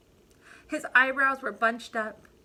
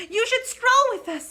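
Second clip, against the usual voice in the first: much higher, louder invitation; grand peacocks; 14 semitones higher, 9 dB louder than usual. Acted. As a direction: call out proudly like a peacock.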